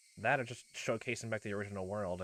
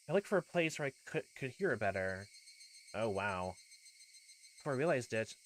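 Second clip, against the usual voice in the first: ordinary delivery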